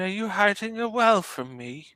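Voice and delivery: usual speaking voice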